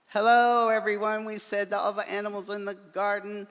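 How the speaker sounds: high, loud calling hello; cheery high hello to all the animals; excited greeting. A narrator's reading. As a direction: call out to the whole garden.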